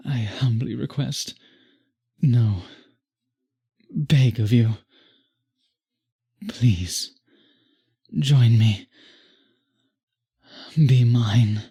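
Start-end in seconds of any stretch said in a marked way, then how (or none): 2.2-8.9 louder, urgent begging; pleading louder. Acted. Vampire begging the listener to join him, louder. 10.7-11.6 forceful whispered command; loud whisper. Acted. Vampire whispering be mine to the listener, forceful.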